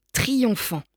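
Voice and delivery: ordinary delivery, nothing marked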